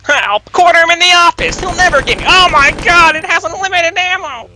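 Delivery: high, loud panicked yelling; mock panic. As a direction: scream it like you're being chased. Voicing panicked game characters.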